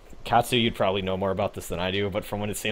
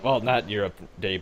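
ordinary delivery

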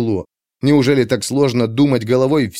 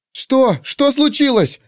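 put-on high, anxious little girl; alarmed girl asking; young girl voice; slower than usual. A narrator's reading.

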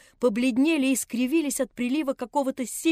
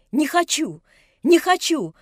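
higher and louder, rising to a cry; building girl's outcry; 5 semitones higher, 7 dB louder than usual. Acted. Nelli refusing the old man, rising to a cry. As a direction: refuse harder each time.

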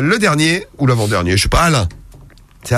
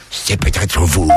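sultry pickup line; 10 semitones lower, much slower than usual. Pretend. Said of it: "vous lui faites".

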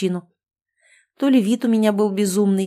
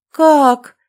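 much higher, very loud scream; shriek of shock; 7 semitones higher, 10 dB louder than usual. Acted. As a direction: scream it so loud it deafens you. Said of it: "заорала я так".

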